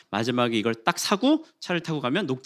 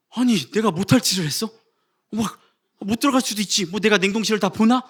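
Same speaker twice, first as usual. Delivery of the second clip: mock angry rant; 7 semitones higher than usual. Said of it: "막 화가 나는데".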